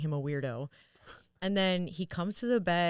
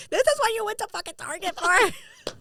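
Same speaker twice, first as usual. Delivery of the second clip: much higher and louder in laughing disbelief; incredulous; 8 dB louder than usual. Retold or imitated. Fiancée asking about the Target trip, incredulous high shriek.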